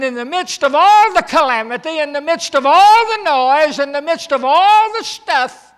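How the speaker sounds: loud, high, hammering preaching; preaching at full force. Acted. Preacher rousing the congregation at the climax, loud. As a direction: pound each phrase harder.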